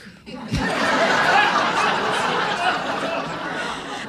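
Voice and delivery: big breathy laugh, rising high. Real, not acted. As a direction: burst out laughing. Laughs at the retold refusal.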